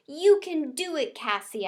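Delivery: high squeaky cheer; squeaky hamster. A narrator's reading. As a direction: squeak it out encouragingly. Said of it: "I squeaked".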